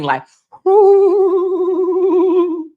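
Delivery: high whooshing wind sound; a long high whoo like wind; wind howl. Retold or imitated. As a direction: mimic the wind howling outside. Imitating the howling wind.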